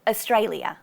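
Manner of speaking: "Australia" with schwa at start and end; Australian way; schwa at both ends. Shown as a demonstration. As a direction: say "Australia" as uh-stray-lee-uh. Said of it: "Same again at the end".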